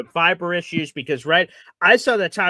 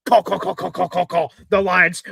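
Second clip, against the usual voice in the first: ordinary delivery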